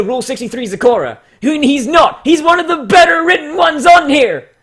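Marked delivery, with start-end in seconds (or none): 1.4-4.5 high, loud protest; indignant shout. Real, not acted. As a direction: jump in to defend him loudly. Defends a story he liked.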